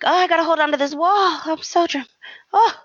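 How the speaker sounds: high fast swooning voice, hamming up the collapse; woozy Liam. Retold or imitated. Mocking Liam as he slumps against the wall, hammed-up swoon. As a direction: clutch the wall like you might faint. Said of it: "He's like".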